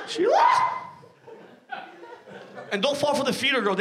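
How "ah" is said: very high startled squeal; startled shriek. Retold or imitated. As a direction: caught red-handed squeak. Girlfriend caught eating.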